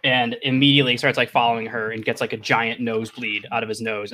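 ordinary talk

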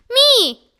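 high, loud eager cry; yell. A narrator's reading. Judy yells to Mister Todd to be chosen, loud and high. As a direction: shout to be picked. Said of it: "yelled Judy".

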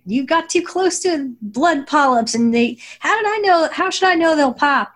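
high-pitched mock scream of indignation; Pearl screaming. Recounting Pearl yelling at Amethyst over her antics, mock screaming. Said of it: "Pearl screaming at her".

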